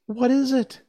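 high, loud surprised question; wondering, pitched right up. A narrator's reading. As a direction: ask in eager astonishment. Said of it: "answered Dorothy in surprise".

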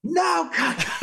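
very high mock scream; screaming director. Director stops yet another walk-out, imitated screaming. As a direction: yell to kill the take, imitating the director. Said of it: "he's screaming over the thing".

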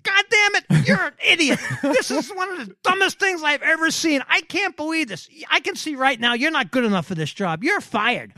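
high, loud furious tirade from the boss; angry boss yelling. Retold or imitated. Boss yelling at his PR guy over the headlines, furious shout. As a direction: blow up at the PR guy like a raging owner. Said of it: "He was pissed".

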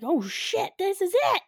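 rising, high-pitched alarm; mock panic. Manager feels the building shake, mock panicked realization. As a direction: build to a hammed-up dawning panic.

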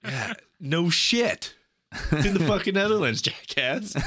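mock-indignant retort, pitched high; exasperated comeback. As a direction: throw the blunder back, half-joking. Acted exasperated comeback to friend.

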